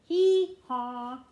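loud braying hee-haw like a donkey. Acted. The donkey in the song braying, loud. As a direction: bray it out, loud and high.

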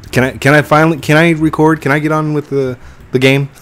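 mock frustration. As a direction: ham up your impatience. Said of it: "I'm just kidding".

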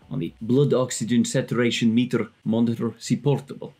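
bad Russian accent, hammed up; terrible Russian accent. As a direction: lay on a clumsy Russian accent. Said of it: "for the terrible Russian accent there".

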